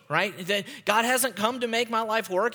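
ordinary talk, nothing marked

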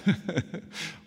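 short breathy laugh. Real, not acted. Preacher laughing at his own squeaky what, breathy chuckle. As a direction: chuckle at yourself.

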